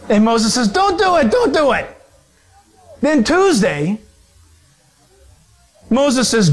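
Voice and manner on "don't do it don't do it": high, urgent protest; urgent pleading. Acted. Moses begging God to hold back, urgent pleading. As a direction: plead to stop him, pitched up high.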